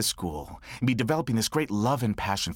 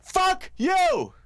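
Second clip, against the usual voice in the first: high angry shout; furious yell; 3 dB louder than usual. Retold or imitated. Father storming into the principal's office, mock furious yell. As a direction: blast it at the principal. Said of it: "go storming into the new principal's office".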